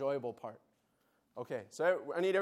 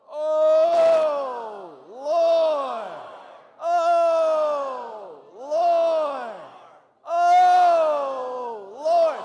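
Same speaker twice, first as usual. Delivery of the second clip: shouted Oh, Lord, much higher and louder; loud cry; 11 semitones higher, 14 dB louder, much slower than usual. Shown as a demonstration. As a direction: cry out Oh, Lord with all your breath. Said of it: "So you go".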